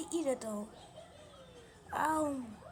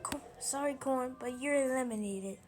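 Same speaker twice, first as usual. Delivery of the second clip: ordinary talk, nothing marked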